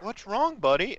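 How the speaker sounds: high, soothing what's wrong buddy; tender concern. Retold or imitated. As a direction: coax a frozen phone gently. Talking tenderly to his frozen phone.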